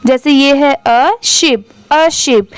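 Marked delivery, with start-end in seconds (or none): none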